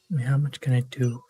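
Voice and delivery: normal talking voice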